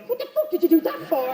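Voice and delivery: a burglar furious at being hit; outraged burglar. Retold or imitated. Burglar hit with frying pan.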